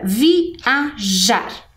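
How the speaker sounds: "viajar" split slowly into vi, a, jar; slow, segmented "viajar"; syllable by syllable. Shown as a demonstration. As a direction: sound out "viajar" in three separate pieces. Teacher sounds out syllables.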